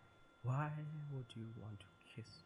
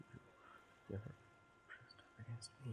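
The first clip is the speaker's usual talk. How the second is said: whispering; 2 semitones higher, 6 dB quieter, faster than usual. Acted. She presses close.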